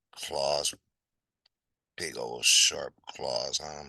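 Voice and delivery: plain reading voice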